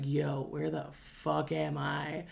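slow, lost and dazed. Retold or imitated. Stoned him lost outside the store, slow and dazed. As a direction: drag it out like you've just come to. Retelling his stoned confusion.

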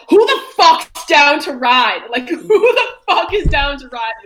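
high, loud, slow yelling; mock street shout. Recalling stomping around the street, loud high slow mock shouting. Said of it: "stomping around the street, being like".

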